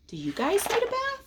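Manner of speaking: ordinary delivery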